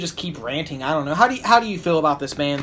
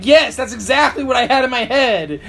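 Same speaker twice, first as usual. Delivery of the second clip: laughing mock approval; 6 semitones higher, 5 dB louder than usual. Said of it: "and I'm like".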